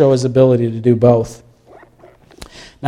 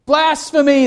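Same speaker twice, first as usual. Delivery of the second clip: high, mock-outraged cry of blasphemy; Blasphemy cried up high in imitation; shouted accusation; 10 semitones higher than usual. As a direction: throw out the word like an accusing scribe. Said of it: "they shouted".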